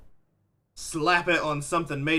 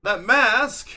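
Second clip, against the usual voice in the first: higher, louder burst of excitement; excited exclamation; 5 semitones higher, 5 dB louder than usual. Acted. Game master voices a character reacting to a mask, excited exclamation. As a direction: exclaim it, pitched up and loud. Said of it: "He goes".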